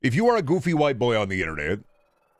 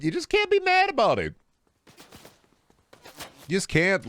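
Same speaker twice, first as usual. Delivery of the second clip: much higher squeaky falsetto; mock falsetto; 11 semitones higher than usual. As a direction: squeak it out playfully. Sudden falsetto repeat.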